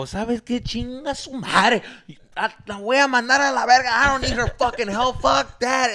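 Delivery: high, loud bilingual cursing; getting mad. Retold or imitated. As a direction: act out a proud, angry outburst. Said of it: "I was getting mad again".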